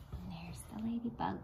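soft unvoiced whisper. Real, not acted. Whispered aside to children.

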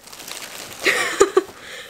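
breathy high-pitched giggle. Real, not acted. Joking about the shirt-tucking rule, a high giggle. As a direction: laugh at her own joke.

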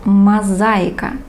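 Мозаика read slowly, syllable by syllable; slow model reading. Shown as a demonstration. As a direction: spell out Мозаика slowly for the class. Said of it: "And the last one".

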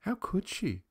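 put-on hushed, high-pitched child's remark; aside to sisters. A narrator's reading. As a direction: confide it to allies, dismissing the outsider. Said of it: "for their especial benefit".

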